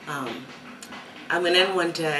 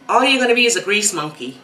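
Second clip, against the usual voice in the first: quick, louder mock teacher's dismissal; retold put-down; 4 semitones higher, 8 dB louder, faster than usual. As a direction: imitate a teacher writing off a pupil, louder and higher.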